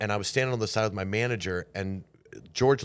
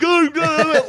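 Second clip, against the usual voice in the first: much higher, loud, giddy no-no-no; giddy protest; 10 dB louder than usual. Retold or imitated. Clinging to his hero on stage, giddy protest. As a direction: refuse to let him go, laughing inside. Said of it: "And I'm like".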